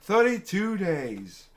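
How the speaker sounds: slow, drawn-out mimicry of a stuck speaker; mock a politician looping one phrase, word by dragged word; mocking Kamala Harris. Host mimics Kamala Harris when the teleprompter went down, mocking, slow. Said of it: "When the teleprompter went down, she's".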